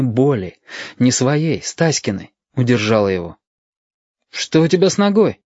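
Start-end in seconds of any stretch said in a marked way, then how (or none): 4.3-5.4 put-on higher worried boyish voice; boy asking about his friend's injury; concerned boy. A narrator's reading. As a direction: ask with sudden concern. Yashka senses friend's pain.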